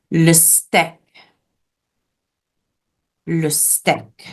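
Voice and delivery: French "le steak" with a short vowel, like "steck"; short vowel sound. Shown as a demonstration. Teacher shows class how French changes steak, short vowel. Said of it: "it makes a short vowel sound".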